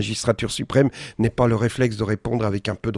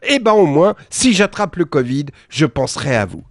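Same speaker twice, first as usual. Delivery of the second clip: high and louder, gushing fan voice fading down; mock fan; 5 semitones higher, 4 dB louder than usual. Fan lady tells the politician she'll think of him, high gushing. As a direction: start squeaky and eager, settle at the end. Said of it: "quand elle ajoute".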